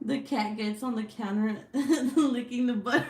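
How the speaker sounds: breaking into helpless giggles; laughing. Retold or imitated. Streamer retelling a friend's cat story, cracking up.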